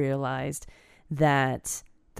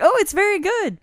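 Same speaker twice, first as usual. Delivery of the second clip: much higher, overly polite; 4 dB louder than usual. Retold or imitated. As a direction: chirp it politely. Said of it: "I was like".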